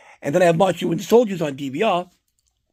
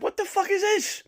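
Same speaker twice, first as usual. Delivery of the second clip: high, stunned disbelief, a bit softer; shocked high-pitched what-the-hell; incredulous outburst; 5 dB quieter than usual. Retold or imitated. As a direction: squeal out the disbelief at the TV schedule. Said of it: "I'm like".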